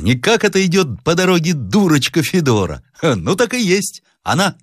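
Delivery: high-pitched servant spotting someone on the road; Egor the servant. Acted. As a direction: point her out to the master, pitched high. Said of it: "сказал Егор". (слуга высоким голосом указывает барину)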